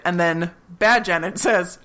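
ordinary talk

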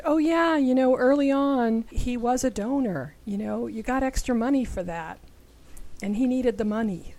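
mom's offhand admission. Retold or imitated. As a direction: breezily recount it as the mother, easing down. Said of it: "her mom said".

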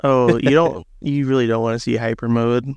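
ordinary talk

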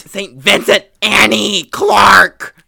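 loud, slow, high announcer voice; grand announcement. Acted. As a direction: announce her like a headliner.

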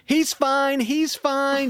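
high-pitched calling-out of "he's fine"; retold yelling. Dog owner yelling at the jogger, mock high yell. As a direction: shout the reassurance up high at the runner. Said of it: "She's yelling at me".

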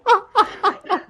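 burst of laughter. Real, not acted. Laughing at the silly name.

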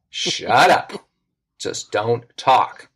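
imitated firm dad, slowing right down; firm hushing. Dad about to pull a scheme, firmly hushing his kids. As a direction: spell it out slowly for the kids.

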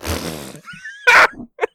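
a high laughing yelp of disbelief; loud burst of laughter. Real, not acted. Recalling the DM's rule, bursts out laughing. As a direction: burst out laughing at the absurd demand.

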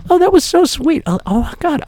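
hammed-up flustered delight; mock gushing. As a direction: melt over the sweet greeting. Gushing over the warm greeting.

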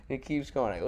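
ordinary talk

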